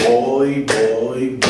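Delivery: "Voy" grave y alargado, uno por paso; pulso de caminata. Shown as a demonstration. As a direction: marca el paso con cada "voy". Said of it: "Estoy caminando". (recitado regular al andar)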